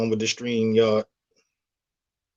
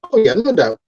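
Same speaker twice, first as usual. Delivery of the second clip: excited; 8 semitones higher, 7 dB louder than usual. Real, not acted. Eager agreement with chat.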